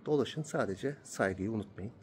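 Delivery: hushed voice inside a church; quiet. Real, not acted. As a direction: keep it low and respectful.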